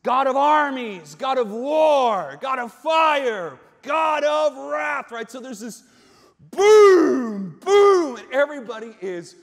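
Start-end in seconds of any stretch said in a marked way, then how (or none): 0.0-5.1 loud, raised and drawn out; thunderous proclamation. Acted. Proclaims translated titles of God. 6.5-8.1 high, loud shouted booms; booming blasts. Acted. Imitates the shaking blasts.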